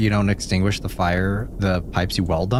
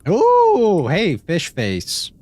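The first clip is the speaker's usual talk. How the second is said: excited high greeting; 10 semitones higher, 5 dB louder, much slower than usual. Real, not acted. Greeting a fish.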